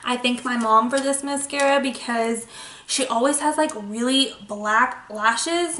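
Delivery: ordinary talk, nothing marked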